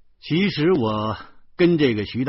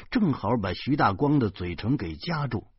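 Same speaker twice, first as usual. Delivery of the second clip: ordinary delivery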